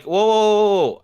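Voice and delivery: high, loud startled "whoa, whoa". Retold or imitated. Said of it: "And I'm like".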